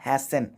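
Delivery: हैसेन with no t sound; correct pronunciation. Shown as a demonstration. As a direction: say हैसेन as 'HAS-en'. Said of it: "इसका correct pronunciation होता है". (हैसेन का सही उच्चारण, 'ट' मौन)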